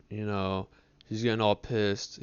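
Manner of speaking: normal talking voice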